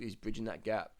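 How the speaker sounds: ordinary talk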